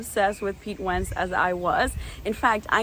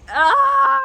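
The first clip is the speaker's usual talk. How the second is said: high loud squeal of Ah; disbelieving squeal; 7 dB louder than usual. Retold or imitated. Recalling meeting her idol, a high loud squeal. Couldn't believe it was him.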